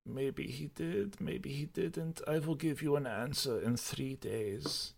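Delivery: high, soft, slow evasive reply; holding back, imitated. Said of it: "like, holding back".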